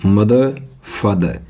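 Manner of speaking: usual speaking voice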